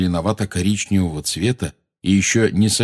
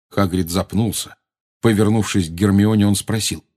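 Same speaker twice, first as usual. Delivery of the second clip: usual speaking voice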